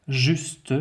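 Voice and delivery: juste with a hissed S before the T; S as in serpent. Shown as a demonstration. Teacher showing pupils the S in juste, pronounced as in serpent. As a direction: say juste with a voiceless S. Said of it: "je prononce donc le S comme serpent".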